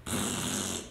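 blown lip-buzz raspberry. Shown as a demonstration. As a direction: demonstrate a rude raspberry noise. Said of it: "blow a raspberry. It's like this".

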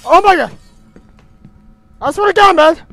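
loud, high panicked shout; startled outcry. Real, not acted. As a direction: blurt it out in sudden fright. Jump scare in horror game.

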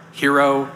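"hero" said like yee-ro, the Greek way; yee-ro pronunciation. Shown as a demonstration. Philadelphia fan offers a third option, yee-ro version. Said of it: "How, how is it?".

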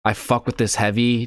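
ordinary delivery

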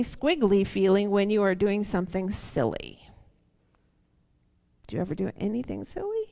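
plain reading voice

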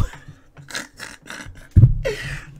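shrieking laugh at his own joke; real cackle of laughter; loud high laugh. Real, not acted. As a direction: burst into a high squealing laugh.

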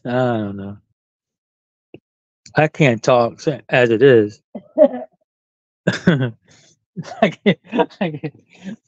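ordinary talk

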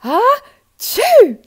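huge loud sneeze, high and sudden; a booming ah-choo from behind a tree. Acted. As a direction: let a massive sneeze explode. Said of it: "a very loud".

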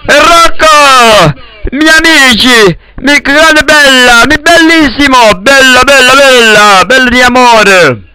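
effusive calling out. Real, not acted. (effusive, high Italian outburst)

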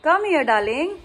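ordinary talk

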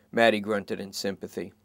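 usual speaking voice

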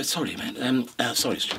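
soft, sheepish mock apology. Guest imagines the co-writer saying sorry to the host, sheepish and soft. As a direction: drop the volume right down and mumble sorry. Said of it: "He'd, he'd probably say sorry".

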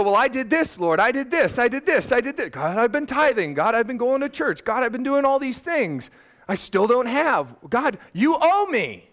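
a hint of a whiny entitled churchgoer, high and pushy; mock complaint; mock entitled whine. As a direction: lightly imitate someone demanding their due from God. Mocking an entitled believer's prayer.

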